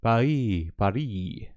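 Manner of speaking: "Paris" said the French way, pah-REE, with no final s; "Paris" à la française, sans le s final. Shown as a demonstration. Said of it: "How do you have to pronounce it correctly?".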